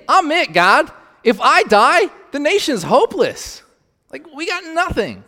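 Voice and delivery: high, loud desperate plea; despairing Elijah. Acted. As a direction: cry out to God in despair.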